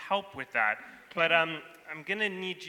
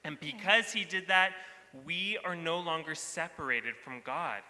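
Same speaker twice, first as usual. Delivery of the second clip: usual speaking voice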